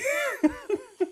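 shrieking laugh, very high; high burst of laughter. Real, not acted. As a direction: let out a squealing laugh. Laugh after the shooting reveal.

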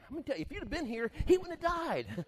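preacher plays upset Martha confronting Jesus. Retold or imitated. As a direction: tell him off. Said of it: "She was upset".

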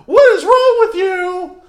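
high, loud indignant cry; mock old lady yell. Old lady scolding a toilet paper thief, high yell. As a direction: shriek it like the slapped-back old lady.

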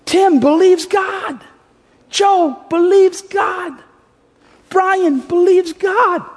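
very high, slow, stretched-out boasting; God, beaming proud. Acted. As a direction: call out each name like a delighted dad. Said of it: "says with a huge smile on his face".

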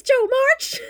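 shrill whiny shout. Retold or imitated. Amy shrieking at Jo.

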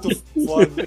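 ordinary talk

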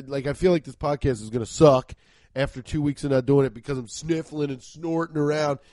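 ordinary delivery

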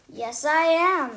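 loud, proud and defiant; brazen admission. Acted. Villain teacher loudly admitting she steals the sheep. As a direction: own it boldly.

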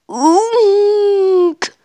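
long, high drawn-out moan; long-drawn eerie noise. A narrator's reading. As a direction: imitate a drawn-out noise from the depths. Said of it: "ein dumpfes, langgezogenes Geräusch".

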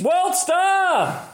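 high-pitched mock holler; crowd hollering. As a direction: whoop like a bystander filming a fight. Mimics onlookers filming the fight.

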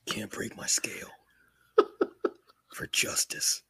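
hushed vigilante whisper; Batman. Acted. Mock hero vowing justice for his scale, hushed whisper. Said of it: "That's Batman type of prep work".

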